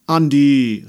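loud fatherly summons; impatient call. A narrator's reading. Papa calling his dawdling daughter, impatient call. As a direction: shout the name up the stairs. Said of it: "rief nun Papa".

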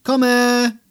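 high, loud drawn-out shout. A narrator's reading. Girl answering Mama in the kitchen, calling loudly. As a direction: sing it out through the house. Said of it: "und dann lauter".

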